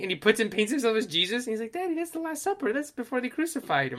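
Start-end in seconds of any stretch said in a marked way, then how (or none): 1.7-4.0 put-on child voice. Kid voice quoted.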